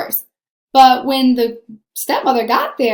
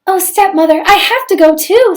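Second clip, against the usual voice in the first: higher, louder eager plea; pleading girl; 7 semitones higher, 6 dB louder than usual. A narrator's reading. Cindy begs the stepmother to attend ball. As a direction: beg to go along, high and bright. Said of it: "said Cindy".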